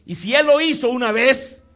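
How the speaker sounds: loud, high declaration of faith; full-force preaching. Real, not acted. Preacher telling the congregation of the miracle, full-force preaching.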